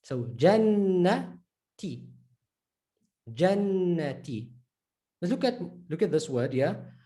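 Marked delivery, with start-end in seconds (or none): 0.3-2.1 Jannati with long N, pause before ti; separated T. Shown as a demonstration. Teacher showing T on its own, separated ending. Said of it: "T can be on its own". 3.3-4.5 Jannati slowly, with a long held N; doubled noon. Shown as a demonstration. Teacher reading the word with shadda, doubled noon. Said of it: "You're gonna emphasize the noon".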